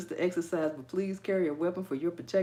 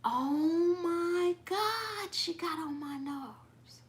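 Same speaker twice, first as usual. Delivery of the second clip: exasperated venting; 11 semitones higher, much slower than usual. Real, not acted. Venting about that yoga woman, drawn-out exasperation. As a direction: drag it out, worn down by the memory.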